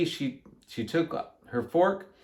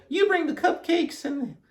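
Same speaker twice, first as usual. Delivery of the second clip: high-pitched character voice; Amelia Bedelia; 12 semitones higher than usual. A narrator's reading. Amelia Bedelia to Alkaloo, high character voice. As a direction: say it up high at normal volume. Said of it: "said Amelia Bedelia".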